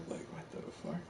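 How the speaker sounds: breathless whisper of shock; stunned by the story, whispered; whispered disbelief. Real, not acted. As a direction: drop to a whisper in amazement.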